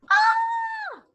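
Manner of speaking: high, loud playful storybook scream; mock scream. Skeleton seeing himself in the mirror, screaming for the kids. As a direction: let out a big cartoon scream. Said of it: "Skeleton screamed".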